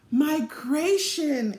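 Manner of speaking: slow, drawn-out answer. A narrator's reading. Guide slowly explains the butterflies' migration to children. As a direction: stretch the word out calmly. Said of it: "answered the guide".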